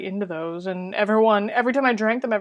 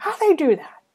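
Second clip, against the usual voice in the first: amazed; 9 semitones higher than usual. Real, not acted. Still amazed.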